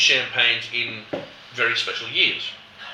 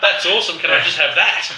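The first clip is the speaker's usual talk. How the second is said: delighted exclamation; 5 semitones higher, 7 dB louder than usual. Retold or imitated. Imitating someone tasting the dosage.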